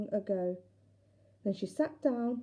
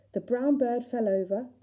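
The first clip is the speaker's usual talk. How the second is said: usual speaking voice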